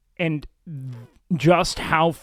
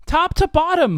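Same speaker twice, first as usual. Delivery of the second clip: exaggerated squeal; 13 semitones higher, 7 dB louder than usual. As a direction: Top to bottom squealed much higher and louder. Repeats phrase in high voice.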